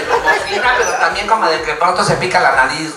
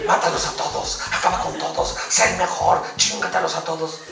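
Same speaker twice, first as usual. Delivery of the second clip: Kakashi; 2 semitones lower, 7 dB quieter than usual. Retold or imitated. Kakashi urging a fighter on, soft hushed commands. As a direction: murmur orders coldly. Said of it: "la voz de Kakashi Hatake".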